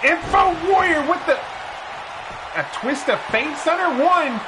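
brisk ringside call, rising with the action; play-by-play commentary. Acted. Commentator calling the match for viewers, energetic play-by-play. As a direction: call the match like a wrestling announcer.

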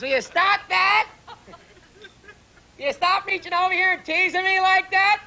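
wife nagging her husband in bed, high and whiny. Acted.

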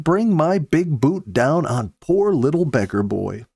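ordinary delivery

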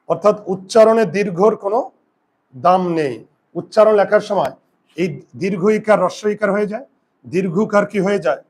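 ordinary talk, nothing marked

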